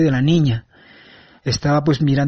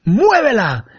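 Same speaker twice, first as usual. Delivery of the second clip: sharp command; 6 semitones higher, slower than usual. Retold or imitated. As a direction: bark the one word like a master giving a command. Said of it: "y me dice".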